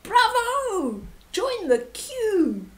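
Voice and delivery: high, slow bravo; cheerful shout. Acted. Two animals welcome the vulture with a cheer. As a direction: cheer the newcomer in. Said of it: "the other two shouted".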